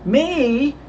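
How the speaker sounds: high, indignant one-word question; startled protest, drawn out high; exclaimed. A narrator's reading. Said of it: "exclaimed Shorty".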